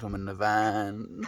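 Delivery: normal talking voice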